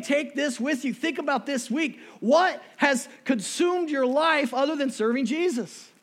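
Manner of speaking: loud, high, driving sermon; full-force preaching. Real, not acted. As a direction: preach it hard at the congregation.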